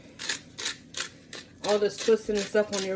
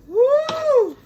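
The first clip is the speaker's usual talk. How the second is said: high whoop; 10 dB louder than usual. Real, not acted. Onion tears, crying out.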